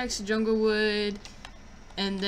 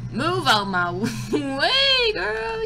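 sassy laughing; 8 semitones higher, 6 dB louder, much slower than usual. Pretend.

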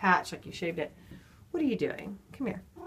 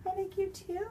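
high, soft cooing; affectionate pet talk; 10 semitones higher, 6 dB quieter than usual. Real, not acted. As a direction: coo it softly up high to the cat. Answering the cat affectionately.

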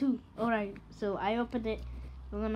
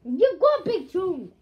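excited; 9 semitones higher, 9 dB louder than usual. Real, not acted. Excited at sibling's chunk.

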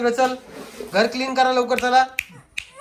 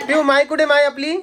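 cooing call; 6 semitones higher, 4 dB louder than usual. Real, not acted. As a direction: call the little one brightly, high and loud. Calling the child, high and loud.

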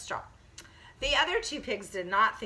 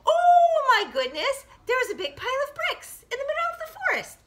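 amazed discovery; 5 dB louder, faster than usual. Acted. Storyteller reveals what the walking pigs found, high and amazed. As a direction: marvel at a lucky find.

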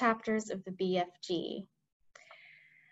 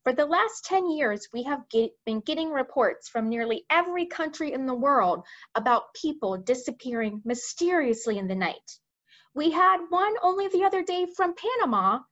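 higher-pitched army chief reporting in earnest; army chief, urgent; 4 semitones higher, 3 dB louder than usual. Acted. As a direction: report alarming news to the monarch. Voices head of army.

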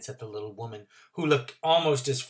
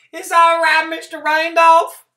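put-on old country woman; 15 dB louder than usual. Trotter voice.

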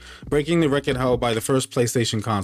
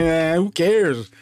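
dismissive; 4 semitones higher, much slower than usual. Retold or imitated. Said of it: "they're like".

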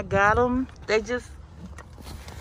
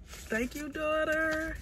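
choked-up soft thanks, higher; emotional; 6 semitones higher, 8 dB quieter, slower than usual. Real, not acted. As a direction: voice catching with emotion. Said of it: "I'm so emotional".

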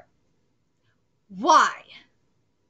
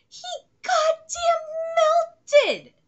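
amazed exclamation; 3 dB louder than usual. Real, not acted. Reactor telling viewers of a wild plot turn, amazed exclamation.

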